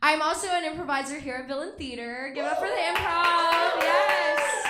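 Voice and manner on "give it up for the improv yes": loud, raised cheer to the audience; rallying the crowd. Real, not acted. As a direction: call out for applause, loudly.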